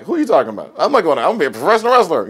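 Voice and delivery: put-on brushing them off with teenage swagger; cocky kid. Cocky kid answers the professor.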